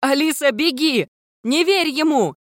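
very high, urgent cry; desperate high-pitched shouting; screamed warning. A narrator's reading. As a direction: scream for a friend to flee. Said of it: "прокричала Виола".

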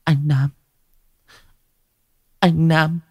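frail voice calling his name; faint whisper-weak. Acted. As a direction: call him weakly from the bed. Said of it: "Giọng của Sao thều thào".

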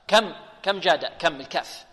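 ordinary talk

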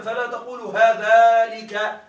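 "هذلك" stretched out very slowly and loud; drawn-out emphasis. Shown as a demonstration. Teacher shows students the forbidden form, "هذلك" loud and drawn out. Sounds out the forbidden example word.

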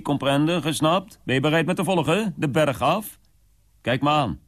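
high, rapid, ranting eccentric host; eccentric Mr Withers. Acted. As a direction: rattle off warnings like a mad old host. Son voices Mr Withers' rant.

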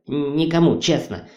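usual speaking voice

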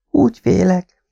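high, sighed confession of fear; fearful sigh. Acted. Kim sighs her fear to her departing husband. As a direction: let the fear slip out on a sigh. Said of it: "sóhajtotta Kim".

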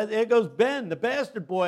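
loud, high schoolyard taunt; taunting kids. Retold or imitated. Schoolkids mock Ben at recess, loud jeering. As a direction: jeer it like a playground bully.

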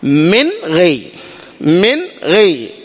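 من غي slow, the noon stopped quickly with only a brief hum; short nasal sound, slow demo. Shown as a demonstration. Teacher repeats the correct short ghunna slowly. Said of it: "ينزل مباشرة".

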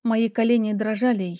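usual speaking voice

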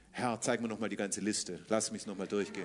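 low, hushed pious mock plea; mocking a fearful religious prayer. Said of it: "Religiös".

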